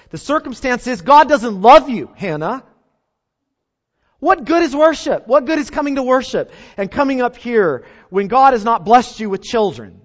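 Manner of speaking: high, loud mocking jeer; preacher voicing the rival's loud scorn; scornful taunt. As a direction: throw the rival's scorn at Hannah. Voices Peninnah's taunt again.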